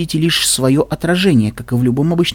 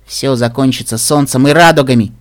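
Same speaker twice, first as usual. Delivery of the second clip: ordinary delivery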